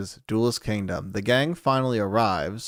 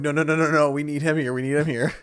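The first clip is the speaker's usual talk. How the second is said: quick, louder string of no's; mock panicked protest; 5 semitones higher, 3 dB louder, faster than usual. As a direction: guards frantically stepping in, lightly acted. Guards jumping in panic, acted.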